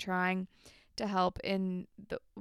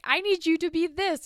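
higher, louder commanding tone; stern boss; 8 semitones higher, 7 dB louder than usual. Retold or imitated. Andrea ordering Paige around, higher and louder commanding. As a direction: lay down the law to the intern.

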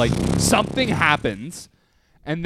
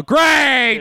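high, loud mock yell; hammed-up shouted annoyance; sarcastic yell; 10 semitones higher, 6 dB louder than usual. Shown as a demonstration. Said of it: "that's when you should yell".

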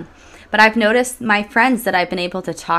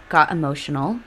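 normal talking voice

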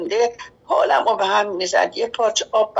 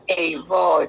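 usual speaking voice

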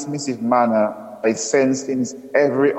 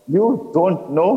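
slow, mocking echo, pitched higher; mimicking; 5 semitones higher, slower than usual. A narrator's reading. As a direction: throw his words back, drawn out. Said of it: "mimicking my earlier speech pattern".

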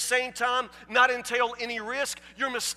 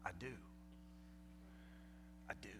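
put-on a small brave boy agreeing; quiet resolve; 29 dB quieter, much faster than usual. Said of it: "And Sam said".

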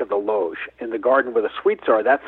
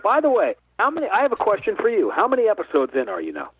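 playfully offended, pitch jumping higher; mock indignant; 10 semitones higher than usual. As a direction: tease the host like you're insulted at being invited so late. Host teased for late invite.

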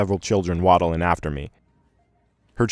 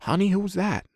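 high, drowsy wife's voice; sleepy mumble; 12 semitones higher, 3 dB quieter than usual. A narrator's reading. Wife half asleep asking her husband, high sleepy mumble. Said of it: "still intoxicated by sleep, mumbles".

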